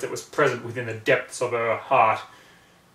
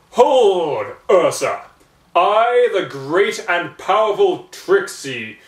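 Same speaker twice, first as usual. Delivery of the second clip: much louder, grand showman's proclamation; loud theatrical higher-pitched decree; Trixie proclaiming; 4 semitones higher, 11 dB louder than usual. A narrator's reading. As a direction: boom it out like a stage magician. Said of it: "beautiful pony proclaimed".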